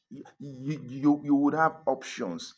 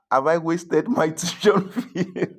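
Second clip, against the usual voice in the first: slow, high, louder half-laughing worried question; laughing disbelief; 6 semitones higher, 7 dB louder, slower than usual. Retold or imitated. Student recalling lecture panic, laughing in disbelief. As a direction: laugh through the panicked thought.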